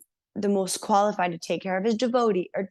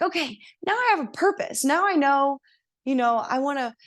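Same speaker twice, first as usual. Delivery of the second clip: excited; 6 semitones higher than usual. Retold or imitated. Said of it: "I was like".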